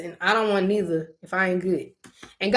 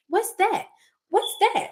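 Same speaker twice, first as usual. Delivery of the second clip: high, quick little mock questions; nosy kids; 11 semitones higher than usual. Little cousins noticing everything about her, mimicked high and nosy. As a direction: imitate curious children in a much higher pitch.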